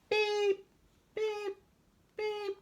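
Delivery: high, loud beeps; beeping horn. Pretend. Boy playing a dump truck honking, high horn beeps.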